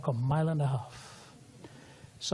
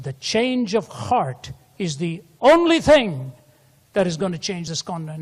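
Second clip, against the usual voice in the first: forceful preaching; 3 semitones higher, 7 dB louder than usual. Acted. Preacher quoting at full force.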